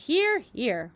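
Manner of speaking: loud hearty cheer; cheering shout. A narrator's reading. Creatures echo their cheer, loud cheer. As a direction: cheer agreement again. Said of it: "they all shouted".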